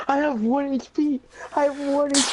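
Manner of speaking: high, wailing panic; hammed-up dying hero wail; panicked wail. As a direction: cry out in mock despair. Player panics at one HP.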